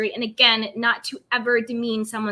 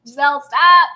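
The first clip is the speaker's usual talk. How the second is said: mock yell; 9 dB louder than usual. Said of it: "I was just like".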